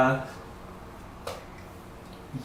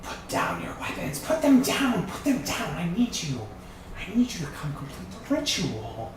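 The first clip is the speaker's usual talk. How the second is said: coaxing monster voice, rising to urgent; talking eyeball; 5 semitones higher than usual. Acted. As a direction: lure them in like a creepy eyeball. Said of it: "the eyeball says".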